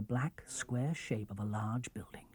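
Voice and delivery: soft unvoiced whisper; whispering storyteller; whispered narration. A narrator's reading. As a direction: hush as the building looms. Hushed narration, dark boathouse.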